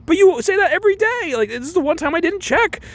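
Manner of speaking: very high, exasperated squeal; mock protest. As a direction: defend yourself in hammed-up indignation. Acted quarrel, Nellie protesting.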